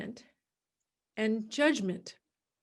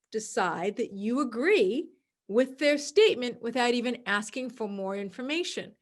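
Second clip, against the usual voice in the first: ordinary delivery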